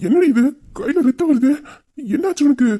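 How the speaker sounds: high, worried, urgent questions; alarmed questioning. Acted. Man sees her hand bleeding, alarmed questioning. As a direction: pitch jumps up in alarm.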